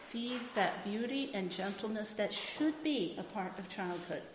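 ordinary delivery

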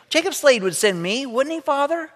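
put-on high, hopeful little girl's plea; little girl pleading. Rachel asking her father hopefully, high little girl voice.

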